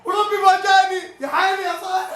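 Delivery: high, laughing praise for the scorer; real joyful fan praise; overjoyed cheering. Real, not acted. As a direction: gush with delight. Praising the scorer after goal.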